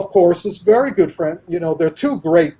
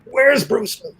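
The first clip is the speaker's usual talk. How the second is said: demanding Sammo; 7 semitones higher than usual. Retold or imitated. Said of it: "And he's going".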